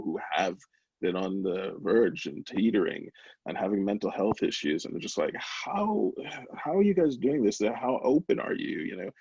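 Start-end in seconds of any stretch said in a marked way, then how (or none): 5.3-8.8 high-pitched wondering questions; retold amazement, starting high and easing down; imitating amazed messagers. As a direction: act out people's disbelief.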